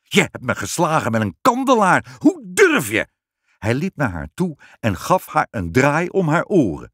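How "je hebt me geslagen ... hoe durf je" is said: outraged accusation, climbing high at the end; king in a rage; furious. A narrator's reading. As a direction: accuse her, building to an indignant cry. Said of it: "riep de koning wit van drift".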